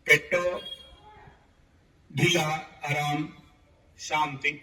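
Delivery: spaced-out, soothing phrases that sink away at the end; guided relaxation. Real, not acted. Yoga teacher relaxing students from belly to neck, guided meditation. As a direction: let each word settle before the next, easing down. Leads body-part relaxation.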